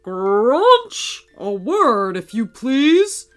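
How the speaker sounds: loud summons, rising at the end; shouting. A narrator's reading. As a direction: call out loudly across a theatre as Santa. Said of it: "cupping his hands beside his mouth and shouting".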